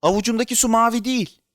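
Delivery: put-on high, astonished child voice; amazed child. A narrator's reading. Boy looking at water in his palm, amazed. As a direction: announce a startling discovery.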